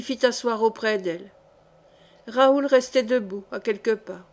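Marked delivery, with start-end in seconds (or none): none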